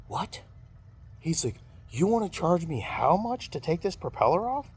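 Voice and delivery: shocked high-pitched mock outrage; outraged disbelief. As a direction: play the friend who can't believe the bill. Friend shocked at the price.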